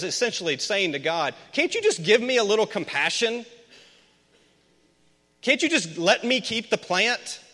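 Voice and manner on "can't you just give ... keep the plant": pleading Jonah. Acted.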